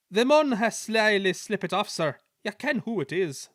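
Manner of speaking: Scottish twins in chorus, sly; Scottish accent. A narrator's reading. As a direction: twin Scottish engines explaining to the Controller in Scottish accent. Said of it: "the engine spoke in chorus".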